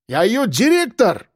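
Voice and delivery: high-pitched, self-important Caucasian man; authoritative Akbar. A narrator's reading. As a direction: declare it with pompous authority. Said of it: "авторитетно заявил Акбар".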